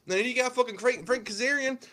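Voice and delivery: high, loud ranting imitation; Cornette impression. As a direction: rant like a cranky old booker.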